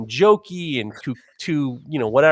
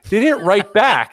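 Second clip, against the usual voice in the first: louder dramatic repeat; 6 semitones higher, 5 dB louder, slower than usual. Real, not acted. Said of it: "repeat for dramatic effect".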